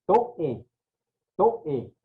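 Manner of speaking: toe in two syllables, to-É; broken diphthong. Shown as a demonstration. Teacher showing the word, toe split as to-É. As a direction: split toe after the o and stress the e. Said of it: "ahí se rompe el dictongo".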